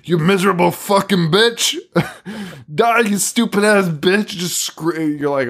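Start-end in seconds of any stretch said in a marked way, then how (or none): 0.0-4.3 furious screamed insults, pitched higher; insane screaming. Retold or imitated. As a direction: scream it at her in a blind rage. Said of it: "does that insane screaming".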